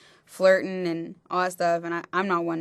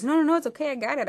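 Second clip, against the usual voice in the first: imitating a drunk man's hurried reassurance; drunk guy placating; 9 semitones higher, faster than usual. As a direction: talk her down like a drunk caught out. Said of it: "and he goes".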